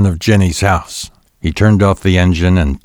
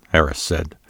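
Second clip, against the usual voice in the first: usual speaking voice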